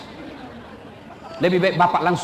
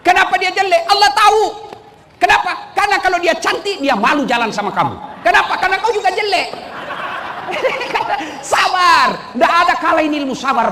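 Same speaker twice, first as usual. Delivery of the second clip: much higher, shouting sermon voice; full-force preaching; 11 semitones higher, 5 dB louder than usual. Acted. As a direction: preach it loud to the crowd. (khotbah berapi-api dengan suara lantang)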